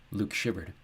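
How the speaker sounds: ordinary delivery, nothing marked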